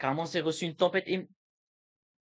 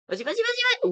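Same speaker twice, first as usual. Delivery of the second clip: excited shout; 6 dB louder, faster than usual. Real, not acted. Cheering the final fight.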